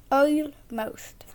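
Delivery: ordinary talk, nothing marked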